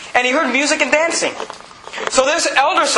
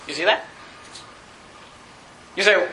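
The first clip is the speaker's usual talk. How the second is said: ordinary talk, nothing marked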